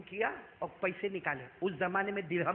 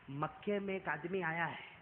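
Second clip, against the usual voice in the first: softer, lower, confiding; a quiet old woman's caution; hushed warning; 3 semitones lower, 7 dB quieter than usual. Acted. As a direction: lean in and warn softly. Old woman warns in secret.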